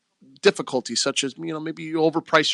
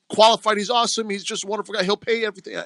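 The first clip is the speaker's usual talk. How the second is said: gushing caller; 7 semitones higher than usual. Retold or imitated. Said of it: "guy goes".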